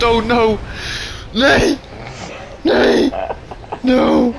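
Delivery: high, loud screams of horror, half laughing; panicked screaming. Real, not acted. Gamer screaming as his hardcore character dies.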